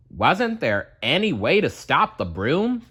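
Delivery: ordinary delivery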